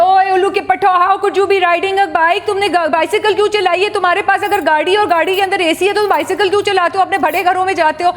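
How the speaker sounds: high, fast, jeering bully rant; a bully berating girls who rode bikes, high and fast; mocking bully. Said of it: "made a point that".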